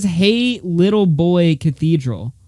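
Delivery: each word read slowly, in disbelief; slow careful reading. Real, not acted. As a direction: spell it out slowly. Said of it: "am I reading that wrong".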